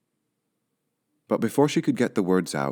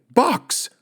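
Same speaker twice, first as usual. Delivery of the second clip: high, loud outburst; exclaimed; 5 dB louder than usual. Acted. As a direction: blurt it in shock. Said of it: "exclaimed Missus Rogers".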